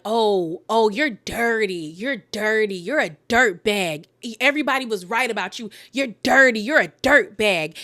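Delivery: heated insult. Retold or imitated. Danielle calling Jennifer dirty during the fight, mock anger. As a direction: spit it out like an accusation. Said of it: "things get heated".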